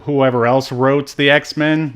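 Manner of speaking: usual speaking voice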